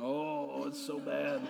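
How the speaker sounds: hammed-up moan, slow and laughing; mock groan. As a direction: overplay being crushed, with a laugh. Volunteer acting burdened.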